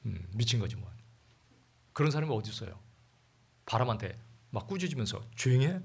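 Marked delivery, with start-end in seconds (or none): 5.4-5.8 mock scolding. Man scolding the wind.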